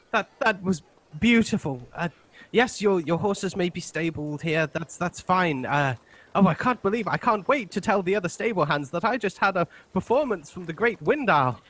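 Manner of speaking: awestruck stable hand, high-pitched gushing; moved to tears. Acted. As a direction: gush like a starstruck fan. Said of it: "like, tear in their eye".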